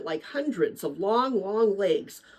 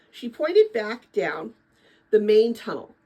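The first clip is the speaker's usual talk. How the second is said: ordinary delivery, nothing marked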